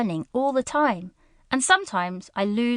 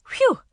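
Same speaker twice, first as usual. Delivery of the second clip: relieved phew; 13 semitones higher than usual. Acted. Relief after crying.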